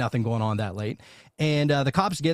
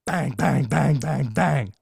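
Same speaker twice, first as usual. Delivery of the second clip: evenly spaced, percussive bangs; each word a separate knock; imitated door banging; much slower than usual. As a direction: mimic insistent pounding on a door.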